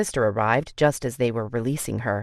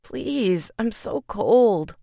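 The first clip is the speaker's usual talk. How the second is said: shivering plea; 7 semitones higher, much slower than usual. A narrator's reading. Feverish woman begging, shivering.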